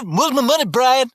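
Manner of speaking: high, sweet, overly polite ask. Shown as a demonstration. Collector showing the customer-service way, high and polite.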